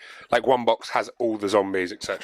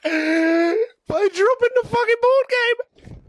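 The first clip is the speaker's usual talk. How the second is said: high, slow anguished cry; pained yell; 4 dB louder, much slower than usual. Real, not acted. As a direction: cry out as the box falls. Drops the game mid-sentence.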